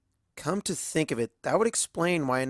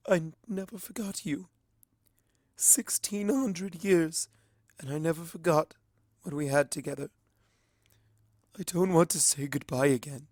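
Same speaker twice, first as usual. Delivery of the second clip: tearful; 6 semitones higher than usual. A narrator's reading. Luna tearful to Starswirl after their kiss. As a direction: voice of a weeping princess, high and hushed. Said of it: "it ends in a small sob".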